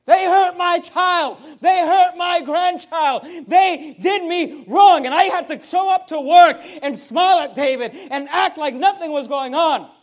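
loud, high, drawn-out shouted grievances; bitter anger. Acted. Preacher acting out the wronged grandfather, bitter angry shout. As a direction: shout each wrong slowly, hammering the words. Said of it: "being bitter and angry and unforgiving".

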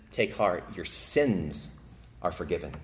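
almost breathe the forgiveness; gentle, fading words of Jesus; hushed pronouncement. Acted.

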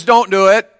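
voice of God. Retold or imitated. Preacher voicing God's prohibition to the students. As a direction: forbid it like God laying down the law. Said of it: "God says".

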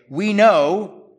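loud, high mock accusation; accusing shout. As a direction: hurl the accusation at him. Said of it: "they say".

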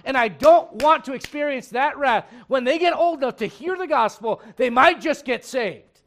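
loud, high, impassioned sermon voice; pounding the point at full force; fiery preaching. Acted. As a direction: drive it home from the pulpit like a revival preacher.

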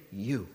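"You" set apart, low and soft, with weight; emphatic YOU. Shown as a demonstration. Preacher shows whom the verse means, "You" isolated and emphatic. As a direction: let "You" stand alone, dropped low before a pause. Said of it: "it's emphatic. He's saying".

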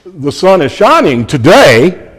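"today" stressed at the end of the phrase. Shown as a demonstration. Preacher showing emphasis by inflection, "today" stressed. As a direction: lean on "today" with a pitch swing. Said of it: "by inflection".

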